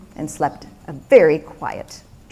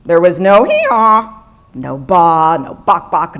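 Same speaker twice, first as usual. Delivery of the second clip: ordinary delivery, nothing marked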